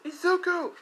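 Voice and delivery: very high acted scream of a name; shrieked name call, story-acted; desperate cry. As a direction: cry out for help, pitch way up. Friend cries out as dragged away.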